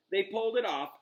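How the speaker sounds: ordinary delivery, nothing marked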